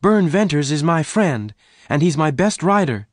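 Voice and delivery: pleading. A narrator's reading. Jane pleads for Venters.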